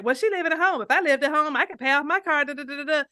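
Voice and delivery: high, loud mock of a know-it-all; judgmental critic. Imitating critics of a woman living at home, high judgmental voice. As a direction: mimic someone quick to judge. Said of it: "be quick to judge and be like".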